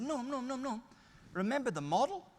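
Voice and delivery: high, insistent repeated calling; calling to brother. Retold or imitated. Reminding brother Norm of the model, high and insistent. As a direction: raise the voice to catch his attention. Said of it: "I said".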